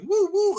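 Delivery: high loud woo woo; woo woo yelp. Retold or imitated. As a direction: yelp it like the song. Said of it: "He just goes like".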